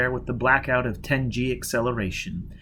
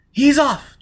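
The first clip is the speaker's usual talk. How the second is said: sudden high cry, louder; excited call; 13 semitones higher, 8 dB louder than usual. Acted. Jiz calling over the radio as the safe launches, excited. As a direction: shout it as the safe flies off.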